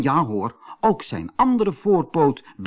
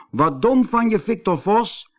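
mocking rabbit, high and louder; Kees Konijn; 10 semitones higher, 4 dB louder than usual. A narrator's reading. Kees taunts the fooled fox, high and mocking. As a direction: tease the fool in a raised pitch.

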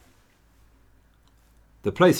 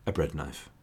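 "a bread knife" dropped much softer, lower, flat and dry; deadpan aside; 4 semitones lower, 10 dB quieter than usual. Real, not acted. Narrator lowers his voice to repeat the gruesome bread knife, softly deadpan. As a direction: let it land quietly, grimly amused. Repeats the grisly detail for effect.

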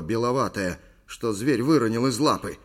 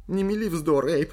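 high-pitched whiny protest; whimpering; 8 semitones higher, 3 dB louder than usual. A narrator's reading. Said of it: "захныкала Ли". (хнычущий голос)

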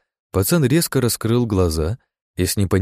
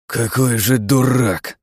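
exasperated; 3 semitones higher, slower than usual. A narrator's reading. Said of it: "фыркнул раздражённый я".

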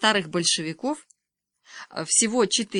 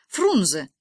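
short, louder announcement; exclaimed answer; 5 dB louder than usual. A narrator's reading. Boy blurts out the capital to classmates, exclaiming. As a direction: call out Фрунзе in one quick burst.

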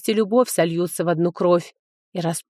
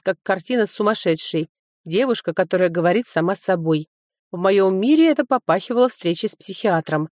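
plain reading voice

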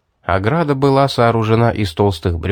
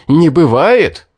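much louder, furious repeat; choking with rage; 5 semitones higher, 7 dB louder, slower than usual. A narrator's reading. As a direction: explode on the second one. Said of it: "задохнулся от ярости".

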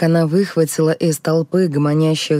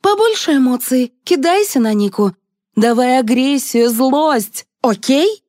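high, forceful coaching; commanding; 9 semitones higher, 3 dB louder than usual. A narrator's reading. Liza demanding aggression from the showman, commanding. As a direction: drive him on with urgent orders. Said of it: "приказала Елизавета".

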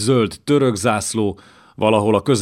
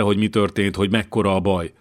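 usual speaking voice

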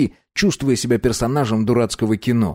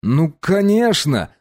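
vehement; 6 semitones higher, slower than usual. Acted. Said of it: "бурно заверил я".

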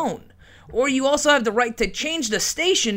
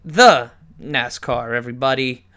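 mock announcer; 10 semitones lower, 4 dB louder than usual. Host mocks the caller's NASCAR.

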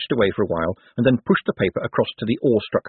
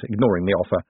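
ordinary delivery

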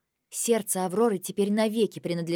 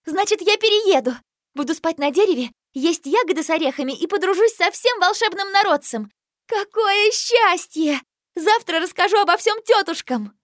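much higher and louder squeal; squealing with delight; 11 semitones higher, 9 dB louder than usual. Acted. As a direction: squeal it out, overjoyed. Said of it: "Аврора запищала от восторга".

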